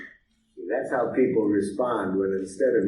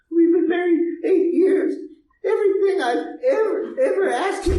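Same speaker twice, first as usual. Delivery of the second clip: much higher, louder tearful wife; 6 dB louder than usual. Retold or imitated. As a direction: pour it out like the upset wife. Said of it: "She says".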